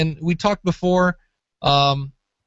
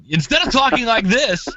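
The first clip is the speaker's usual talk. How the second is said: pumped-up, higher radio DJ voice; obnoxious DJ; 9 semitones higher than usual. Shown as a demonstration. Podcaster demonstrating to a guest an over-the-top DJ voice. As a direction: overdo it like a hyped DJ. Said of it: "go to Obnoxious D J".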